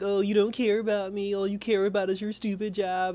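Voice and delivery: mock the son's sulky outburst; upset kid.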